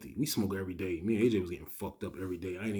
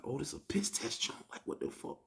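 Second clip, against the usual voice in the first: hushed, conspiratorial whisper; furtive whisper; 5 semitones higher, 9 dB quieter than usual. Retold or imitated. Inmates realize it's a piss test, furtive whisper. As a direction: whisper it to your cellmate in dismay.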